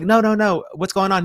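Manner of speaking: high-pitched, eager objection; retold urgent protest. Him stopping his friend from hanging up, high urgent retelling. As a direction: replay your own urgent plea, voice jumping up.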